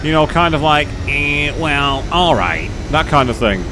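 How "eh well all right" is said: hesitant cartoon scientist impression; lightly imitated cartoon scientist; Simpsons scientist. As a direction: put on a drawn cartoon boffin voice. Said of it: "like the, um, scientist from The Simpsons".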